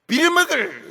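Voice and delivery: loud, high acted curse; Peter cursing. Disciple denying his master before an accuser, loud cursing. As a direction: spit out an oath in mock fury. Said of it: "베드로가 욕을 합니다".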